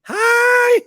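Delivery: very high, loud held "high"; squealed slogan ending; hammed-up high note. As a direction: shoot "high" way up and hold it.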